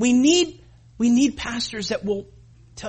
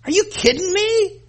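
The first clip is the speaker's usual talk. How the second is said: incredulous; 12 semitones higher, 6 dB louder than usual. Retold or imitated. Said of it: "I'm going".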